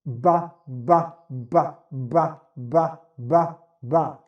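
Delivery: repeated crisp ba ba; ba syllables. Shown as a demonstration. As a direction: pop the lips on each ba.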